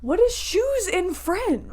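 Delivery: high, slow, loud wail; drawn-out, hammed-up frustration; mock exasperation. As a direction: wail the question in mock despair. Exasperated at forgotten school French.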